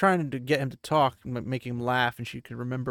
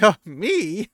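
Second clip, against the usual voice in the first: surprised; 7 semitones higher, 9 dB louder than usual. Acted. Elaine surprised to be the hero.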